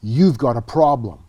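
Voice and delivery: loud, firm call-out to the room; stern warning. Retold or imitated. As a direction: stop the room with a hard warning. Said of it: "the moderator stopped and said".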